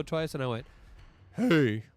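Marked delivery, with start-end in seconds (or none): 1.4-1.8 loud booming hey; big projected call; hollering dad voice. Retold or imitated. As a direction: holler for attention across a counter. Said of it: "my voice is gonna crack".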